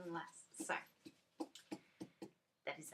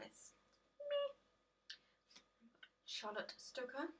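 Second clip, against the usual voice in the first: squeaky high character voice, slowed on the name; silly put-on voice; 6 semitones higher, 4 dB quieter, much slower than usual. Vlogger introduces the mother card to viewers in a squeaky high put-on voice. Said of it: "I don't know why I said that like that".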